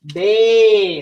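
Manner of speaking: "ba-" as a long held BAY; open syllable demo. Shown as a demonstration. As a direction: stretch "ba-" out, the A saying its name. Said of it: "it's making its name".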